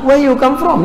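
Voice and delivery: curious face. Shown as a demonstration. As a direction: sound genuinely keen to know where they're from. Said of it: "Muka nak tahu".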